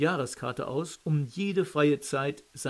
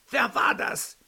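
high, sharp angry shout; shrill scolding; 9 semitones higher, 4 dB louder than usual. A narrator's reading. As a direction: rail at the whole class. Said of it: "zeterte Philipp in die Klasse hinein".

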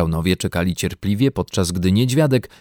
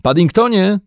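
higher call ending on the name; raised, lively calling voice; 8 semitones higher, 5 dB louder than usual. A narrator's reading. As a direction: call the bear over. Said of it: "zawołał".

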